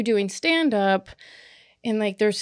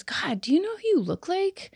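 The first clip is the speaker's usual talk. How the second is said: high, amazed stranger's question; quoted stranger; 9 semitones higher, 3 dB quieter than usual. Retold or imitated. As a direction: gush it like a starstruck fan. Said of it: "She goes".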